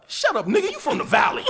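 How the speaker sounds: loud, high comeback with some laughter; mock angry retort. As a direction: act out heated trash talk, amused. Acted trash talk in wrestling scene.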